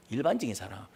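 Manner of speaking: 일반적인 사람 dropped low and soft; 일반적인 said low under the breath; low quiet take. Shown as a demonstration. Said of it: "repeats phrase in two voices".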